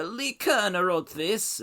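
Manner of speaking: a lightly voiced Borat tag line; mock Borat punchline. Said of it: "I almost saved it with a ... punchline".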